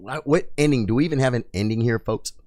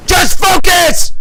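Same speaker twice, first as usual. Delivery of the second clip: very high, drawn-out shout; full-force, high-pitched yell, stretched out; all-out scream; 12 dB louder, much slower than usual. Real, not acted. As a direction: scream it at the climax of the fight. Screamed at moment of boss kill.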